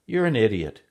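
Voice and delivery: loud, emphatic acted rebuke; scolding hen. A narrator's reading. Hen scolds duckling in story.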